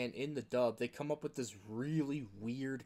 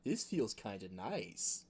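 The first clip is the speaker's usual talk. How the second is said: softer and slow; mock pleased moan; 5 semitones lower, 5 dB quieter, slower than usual. Losing character murmurs softly and slowly while groaning. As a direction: savour it like a creep enjoying the pain. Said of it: "for some reason says".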